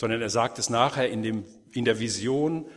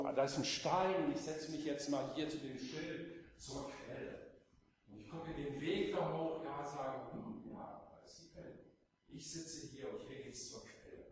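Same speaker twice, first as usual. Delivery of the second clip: quiet musing whisper; 2 semitones higher, 13 dB quieter than usual. Pretend. Sitting by the sign, musing.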